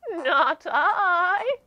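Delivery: shaky sheep. Acted. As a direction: bleat it out shakily. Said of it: "in her shaky voice".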